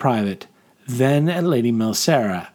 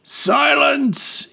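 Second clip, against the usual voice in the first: high, strained battlefield bellow; commanding shout. Acted. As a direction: shout down an angry crowd. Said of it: "he shouted again".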